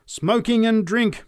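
slow, high, loud denunciation; fervent preaching. A narrator's reading. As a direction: draw it out to the crowd. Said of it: "she continued".

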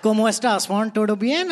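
put-on Spanish spoken with a heavy Indian accent. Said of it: "speaking Spanish in an Indian accent".

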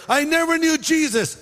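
high, emphatic "I never knew Jesus"; insistent denial. Retold or imitated. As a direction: protest far too much. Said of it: "says Peter".

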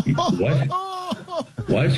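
high mock yelp of disbelief; retold disbelief. Actor reacting to the sound man after an exhausting take, mock disbelief.

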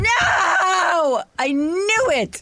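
real cry of defeat; frustrated shout. Real, not acted. Player loses the guessing game, shouting in frustration. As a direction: yell it out as the answer slips away.

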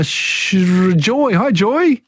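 normal talking voice

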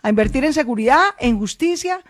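high, forceful campaign call; rally the crowd to go and vote; ringing stump-speech climax; rousing rally finale. Pretend. Rousing close of the requested speech.

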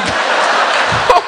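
squeaky high giggle; high laugh. Acted. Comic laughs in a squeaky high voice mid-bit. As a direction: burst into a high-pitched laugh.

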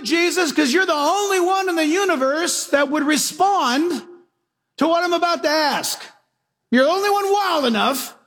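high calling shout to Jesus; calling out over the storm. Retold or imitated. Peter calling to Jesus over the raging storm, high and strained. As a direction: call out across the waves.

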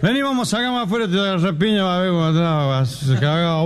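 put-on high-pitched drunk picking a fight; mock tough-guy drunk; belligerent drunk. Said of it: "viene la etapa violenta".

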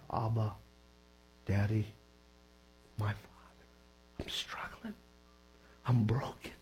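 low, hushed prayer to a father; broken, quiet plea. Acted. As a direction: softly confess it, halting between phrases. Voicing a broken private prayer.

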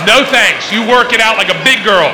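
loud, high-pitched comic refusal; mock firm dad. Dad refusing to soothe his baby daughter, mock firm.

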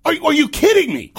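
high, loud disbelief; incredulous outburst. Real, not acted. Host stunned by the call, incredulous shout. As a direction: stumble into an outraged yell.

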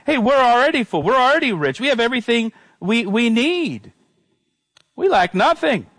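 high-pitched, self-satisfied mock boasting; boastful attitude. Preacher voicing the worldly Corinthians' attitude, mock boastful. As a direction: brag it out as a smug believer, pitched well up. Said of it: "their attitude was".

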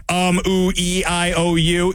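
hesitant string of filler sounds, lightly mimicked; mock a halting speaker with drawn vowels; light, level-pitched parody of ums and ahs; mocking the mayor's talk. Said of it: "We know how she talks".

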